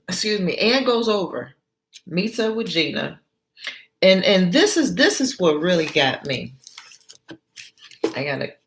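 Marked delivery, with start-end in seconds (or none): none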